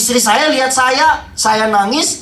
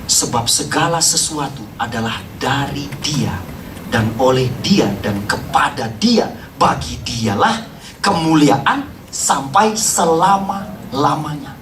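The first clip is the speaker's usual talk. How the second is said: slow, lower, hushed recitation of the verse; solemn scripture reading; 9 semitones lower, slower than usual. Acted.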